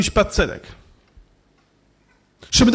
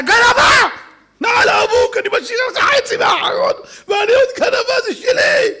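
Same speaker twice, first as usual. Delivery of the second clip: high, loud indignant yelling; higher, louder accusing shout with a crying edge; imagined shouting; 12 semitones higher, 4 dB louder than usual. Retold or imitated. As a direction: bellow at the thief in outrage, voice cracking. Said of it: "שהוא היה צועק עליה".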